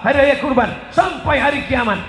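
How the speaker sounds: high, loud building sermon chant; preaching at full force. Acted. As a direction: rattle off the list, climbing to a peak. Preaching list rising to climax. (ceramah berapi-api)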